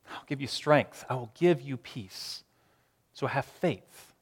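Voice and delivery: soft, slow gentle promise; reassuring. Acted. God promising strength to the overwhelmed, soft and slow. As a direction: tenderly reassure the listener.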